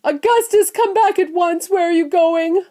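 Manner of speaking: high, loud frantic calling; screaming. A narrator's reading. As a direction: scream after your vanishing son. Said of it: "screamed Missus Gloop".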